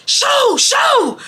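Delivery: high-pitched mock yell of an old man; imitated yelling. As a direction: shriek it like shooing birds. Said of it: "Papa Nichols starts yelling".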